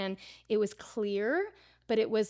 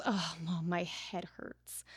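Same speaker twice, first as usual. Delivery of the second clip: put-on softer, slow, faint little-girl voice; imitating weak daughter; 3 semitones lower, 7 dB quieter, slower than usual. Mom quoting her daughter waking with a headache, weak and soft. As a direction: let it come out small and drained.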